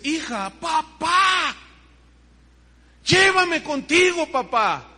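high, loud, slow pleading cry; desperate plea. Retold or imitated. Daughter in the vision crying to her father, high and loud. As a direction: cry out begging to be taken. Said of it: "Y me habla y me dice".

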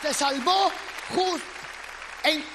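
high, loud shouted preaching over the band; preaching at full force. Acted. Preacher shouting to the congregation over music, full force. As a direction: hammer the promise home to the congregation.

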